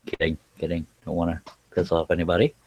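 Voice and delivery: ordinary delivery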